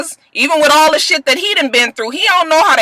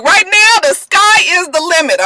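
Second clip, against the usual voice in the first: excited Willie; 7 semitones higher than usual. Retold or imitated. Willie celebrating his freed contract, high and excited. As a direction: celebrate big. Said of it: "So Willie is just like".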